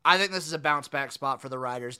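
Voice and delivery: normal talking voice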